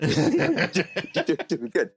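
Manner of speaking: laughing slowly through the words. Retold or imitated. Laughing as he tells the host how Mike pushed him. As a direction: chuckle it out, amused at being pushed.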